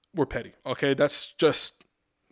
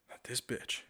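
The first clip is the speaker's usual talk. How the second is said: muttered aside; 2 semitones lower, 14 dB quieter than usual. Real, not acted.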